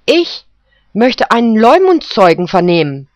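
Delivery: the poodle insists, up and loud; eager poodle. Acted. Poodle demands a character witness, high and loud.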